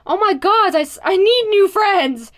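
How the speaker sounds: loud, high, slow exasperation; fed up with his friends; exasperated. A narrator's reading. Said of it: "Tio announced".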